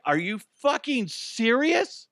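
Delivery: high, slow incredulous question; retold outraged disbelief. Clerk learning from boss the cameras are fake, high incredulity. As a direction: stretch it out, rising in disbelief.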